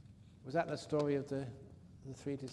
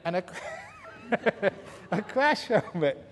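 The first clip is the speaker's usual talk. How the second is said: laughing; 4 semitones higher, 4 dB louder than usual. Real, not acted. Laughing through the punchline.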